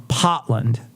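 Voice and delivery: slow "Potland", no R, Maine style; Maine accent. Shown as a demonstration. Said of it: "they say Potland".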